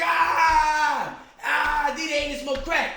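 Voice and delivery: high, shouted mock taunt; jeering schoolkids. Schoolkids tease boy about his aunt, high shouted jeer. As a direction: imitate a crowd of kids teasing. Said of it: "They was like".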